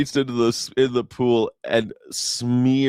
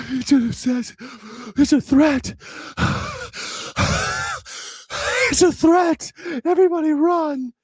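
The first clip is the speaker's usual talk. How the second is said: mock panic. Mocking whiny MAGA fans, shrill high panic. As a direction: ham up a shrieking panicked victim.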